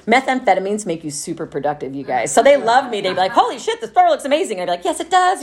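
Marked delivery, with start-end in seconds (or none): 3.3-4.6 high amazed boss voice; mock-impressed coworker, pitched up. Boss gushing over the tidy store, high and amazed. Boss praising her work. 4.9-5.4 high, self-satisfied agreement; smug. Retold or imitated. Replying to her boss about the store, high and smug.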